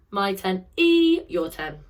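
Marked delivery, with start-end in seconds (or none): 0.8-1.2 high squeaky sound. Shown as a demonstration. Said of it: "do it in a squeaky voice".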